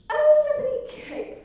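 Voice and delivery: high, slow, airy dismissive line with a laugh; haughty queen. Acted. Marie brushing off the starving people, airy and dismissive. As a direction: toss it off like a careless queen. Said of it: "And Marie replied".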